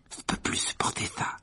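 whispered shivering grumble; muttering, teeth chattering. A narrator's reading. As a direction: mutter it voicelessly, freezing cold. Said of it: "marmonna Ron en claquant des dents".

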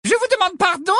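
very high, sugary prim voice; a simpering teacher feigning deafness; high put-on Umbridge voice; Umbridge, loud and prim. A narrator's reading. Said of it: "d'une voix forte".

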